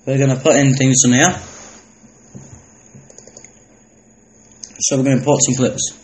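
talking with a mouth full of food; eating between words; chewing while talking. Real, not acted. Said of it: "Sorry if you can hear me eating".

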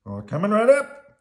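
high, loud, eager shopkeeper; Bert. A narrator's reading. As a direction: sing out an order taken, pitch climbing. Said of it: "said Bert".

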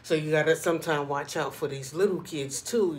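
normal talking voice

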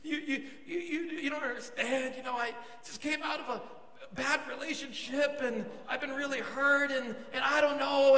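high, tearful mock protest; imitated crying. Woman turning down her suitor, imitated weepy voice. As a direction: whimper it as if breaking into tears. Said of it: "then she begins to cry".

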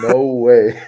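loud, incredulous outburst; retold disbelief. Player retelling his face mask breaking on a huge hit, loud disbelief. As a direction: act out stunned disbelief, loudly.

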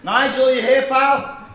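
loud, high call into the crowd; calling out to Nigel across the room. Acted. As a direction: shout out looking for him.

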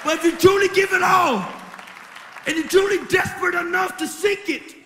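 loud, driving testimony preached to the crowd; loud preacher repeating each line, voice pushed hard; preaching at full force. Acted. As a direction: build it like a revival altar call.

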